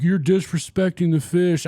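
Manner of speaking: mock scolding. Vlogger mimics the guy who complains about fish handling, mock scolding. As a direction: draw it out like an offended critic.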